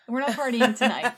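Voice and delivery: loud, laughing mock declaration; a Viking's hammed-up refusal to party, laughing. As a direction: boom it out like a gruff Viking. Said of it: "they don't say it that way".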